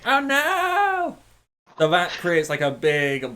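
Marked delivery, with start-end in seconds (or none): 0.0-1.2 high squealing oh no, then a crying ah; panicked apple snake. Acted. Game master voicing the apple snake hit by moonbeam, high panicked squeal. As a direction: squeal like a terrified cartoon monster.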